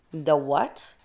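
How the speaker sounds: confused, with a sharp questioning rise; puzzled query. A narrator's reading. Sleepy bear baffled by the mouse's news, puzzled question. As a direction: ask it baffled, swooping up at the end.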